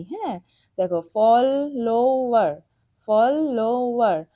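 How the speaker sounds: Follower said slowly and clearly for the class; slow model reading. Shown as a demonstration. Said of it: "teacher models word slowly". (Follower ধীরে স্পষ্ট করে বলা)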